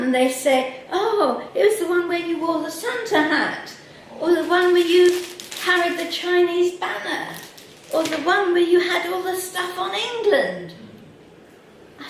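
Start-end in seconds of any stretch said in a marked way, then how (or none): 0.9-10.7 gushing fan. Retold or imitated. Fans recalling her speeches, voiced.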